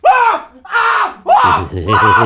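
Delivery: loud high screams of pain; agonized screams. Acted. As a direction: scream as if under the knife. Patient screaming behind door.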